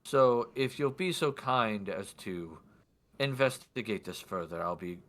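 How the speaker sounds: formal, weighty request. Acted. Lord asking guard to investigate, deliberate formal voice. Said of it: "do my best for his voice".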